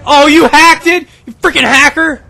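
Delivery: loud, high outburst at a cheater; real accusing yell. Real, not acted. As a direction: yell the accusation at the other player. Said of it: "I can actually yell that".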